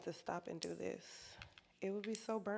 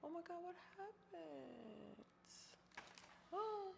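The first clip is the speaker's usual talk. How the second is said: shocked; 10 semitones higher, 7 dB quieter, slower than usual. Real, not acted. Gimbal suddenly dies mid live.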